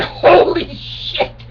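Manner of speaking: hushed, drawn-out, breathy shock; shocked gasp. Acted. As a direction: gasp it out in disbelief. Shocked reply to his penis.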